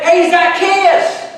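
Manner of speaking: calling by name. Acted. Jesus calling up to Zacchaeus in the tree, hailing. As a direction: hail him loudly and slowly by name.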